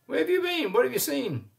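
high, squawking hen voice; croaking hen. A narrator's reading. Dorcas the hen greets Prosper in a high squawk. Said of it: "croaked Dorcas".

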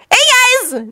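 high cheerful greeting; chirpy high-pitched hello. A narrator's reading. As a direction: greet them brightly in a high boy voice. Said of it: "Naruto said cheerfully".

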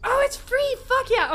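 high, loud joyful shout; real excited whoop; delighted. Real, not acted. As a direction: celebrate the free coffee. Delight at free coffee.

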